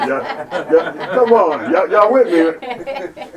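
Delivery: loud, slowed call to the congregation; rally the church, drawn out; preaching. Real, not acted. Preacher calling the congregation to follow, loud and slow.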